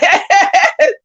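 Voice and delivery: high-pitched imitated cackle; mock cackle. Mimicking the woman who laughs every time you turn around, mock cackling. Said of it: "And she cackling, cackling".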